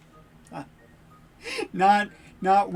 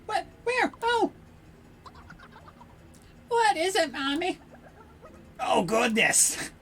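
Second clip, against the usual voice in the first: higher, wide-eyed alarm; startled villagers; 10 semitones higher, 4 dB quieter than usual. Acted. A child asking Mommy about the sky, lightly voiced alarm. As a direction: gasp and point like spooked villagers.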